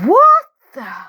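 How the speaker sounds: high, loud startled cry; shocked. Acted. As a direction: blurt it out in shock. Startled exclamation.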